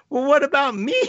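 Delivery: high-pitched imitation of a stranded friend; pleading roommate. Stranded roommate begs her over the phone, high mock plea. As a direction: pitch it up like a whiny plea from the roadside.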